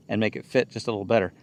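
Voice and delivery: ordinary delivery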